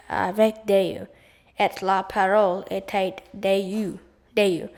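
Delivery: slow French with "était" said like English "ay-tate"; French mispronounced as spelled. Acted. Nat reads French to himself as spelled, mispronouncing "parole" and "était". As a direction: sound out "commencement" as the English word and "Dieu" as "day-you". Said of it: "that isn't the way to pronounce it".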